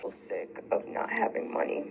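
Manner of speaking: crying under her breath; whispered through tears, sick of it all; tearful whisper. Real, not acted. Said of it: "why are you crying".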